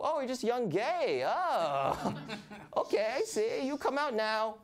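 high, soothing mom voice. Retold or imitated. Mother consoling her son about girlfriends, high cooing voice. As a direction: coo at him like a mother.

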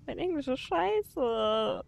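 ordinary talk, nothing marked

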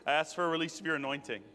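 murmured prayer. Real, not acted. Asking the Holy Spirit to come on them, low prayer. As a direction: pray low and repetitively over the room.

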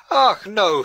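exclaiming. A narrator's reading. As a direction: burst out with a merry denial. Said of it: "she exclaimed".

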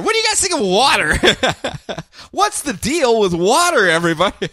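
mock observational comedian working the crowd; hack stand-up comic. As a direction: parody a cheesy stand-up opener.

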